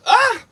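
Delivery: sudden high yelp; sharp high yelp. Real, not acted. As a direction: yelp out in surprise.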